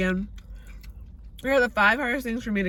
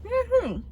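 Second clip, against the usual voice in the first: sarcastic woohoo; 14 semitones higher than usual. Real, not acted. Sarcastic cheer.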